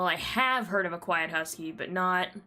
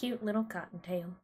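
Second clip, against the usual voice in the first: Western accent; 3 dB quieter than usual. Real, not acted. Cooing at a rabbit in her Western accent. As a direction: say it like a Western country speaker, gently. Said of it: "I say that with, like, such a Western accent".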